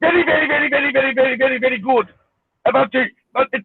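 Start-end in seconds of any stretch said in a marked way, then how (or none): 0.0-2.1 hammed-up Indian accent, words rattled off in a chain; mock the Indian accent with rapid repetition. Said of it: "There's your accent".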